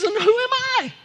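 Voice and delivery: very high, loud cry; the Lord's indignant challenge. Acted. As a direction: fling the question out in outrage. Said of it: "and he says".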